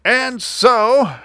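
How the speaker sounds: very high creature voice; indignant little creature; aggrieved Lorax. A narrator's reading. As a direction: carry on complaining in a high cartoon voice. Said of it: "said the Lorax".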